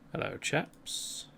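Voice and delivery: slow, whispered British "Hello, chaps"; a hushed, drawn-out posh British hello; British greeting. Retold or imitated. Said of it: "very much British version".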